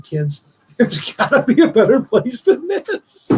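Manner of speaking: real tearful laugh, slowed; a wet laugh breaking into the words; half-crying chuckle, slow; laughing through tears. Real, not acted. Grieving speaker laughs through tears.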